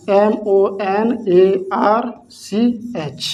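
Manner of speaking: slow letter-by-letter spelling; drawn out dictation of letters. Shown as a demonstration. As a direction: spell each letter slowly for the class. Teacher spells word letter by letter.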